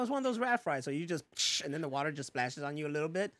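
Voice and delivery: ordinary talk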